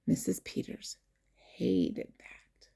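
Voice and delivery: hushed conspiratorial whisper; whispered aside to the listening children. Acted. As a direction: breathe it like a secret.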